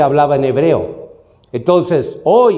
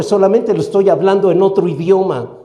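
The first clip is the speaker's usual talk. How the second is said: ordinary talk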